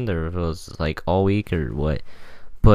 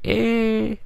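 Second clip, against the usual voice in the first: much higher sad "Eh."; higher sad noise. Shown as a demonstration. Showing viewers his sad voice climbing, much higher "Eh.". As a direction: push the sad "Eh." way up in pitch. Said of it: "And my voice gets higher too".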